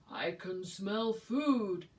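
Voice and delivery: slow, loud bear voice; bear yelling. Acted. Bear calling out as he smells the picnic. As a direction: boom it out slowly like a big bear. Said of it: "yelled a voice from nearby".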